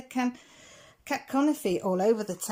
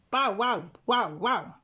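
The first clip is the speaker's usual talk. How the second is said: dog barking. A narrator's reading. Bitsy Maloney barks.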